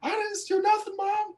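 very high squeaky denial; whiny kid. Retold or imitated. Accused kid insisting to his mom, very high whine. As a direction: plead innocence in a squeak. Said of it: "He's like".

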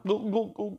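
high soft gu gu gu gulps; gulping sounds. Acted. Storyteller imitating the crowd gulping, high and soft. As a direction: gulp down the drink.

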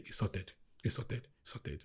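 very soft, low repetition, fading away; quiet self-assurance. Retold or imitated. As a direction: murmur it over and over to reassure yourself. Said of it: "I just said".

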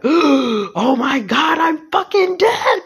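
slow, high, loud shocked gasp. Retold or imitated. Seeing own body dead, high shocked exclaim. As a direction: let the shock hit you on each word.

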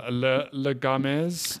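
playful fake French accent; mock French. Co-host echoing the joke title, mock French. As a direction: put on bad French for the segment title. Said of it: "how you say it in French".

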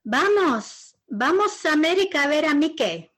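high, loud, quick coaxing in Spanish; excited father's plea; father coaxing child. Retold or imitated. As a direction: urge the little girl onto the plane. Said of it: "my father said to me".

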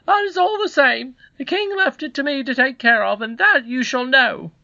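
very high, loud, indignant scold; scolding housekeeper. Acted. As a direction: berate the boy in a high-pitched character voice. Voices housekeeper in story.

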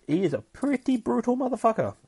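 high excited squeal; gleeful emphasis. Real, not acted. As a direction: gush over how brutal he is. Enthusing about Dakin.

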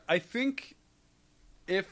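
losing the thought mid-sentence; trailing off. Real, not acted. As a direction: voice drifts away unfinished. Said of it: "I lost my train of thought there".

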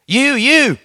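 put-on high, trilling old-lady voice; old lady recognising the preacher, high and trilling. A narrator's reading. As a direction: trill it like an excited old woman. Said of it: "one old lady trilled".

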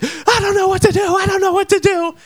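high wailing imitation of a crying girl; mock bawling. Car owner crying in the street, mock high wail. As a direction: whine it high like she's sobbing. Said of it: "is bawling, crying, just being like".

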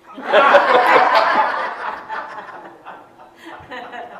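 hearty laugh. Real, not acted. Laughs after own punchline.

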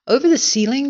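high, incredulous question; astonished cry. A narrator's reading. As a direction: cry out in disbelief. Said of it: "they cried".

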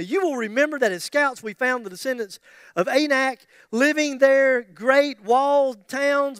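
loud, emphatic declaration, slowing at the end; forceful, stretched-out vow; Caleb. Acted. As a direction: proclaim the claim like a bold old warrior. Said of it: "listen to him. He said".

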